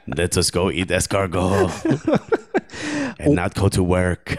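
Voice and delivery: mock French accent slipping into Argentinian; comic French-Argentinian accent; Karim Benzema. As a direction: put on a wobbly foreign accent, French drifting Argentinian. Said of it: "I promise this is Karim Benzema".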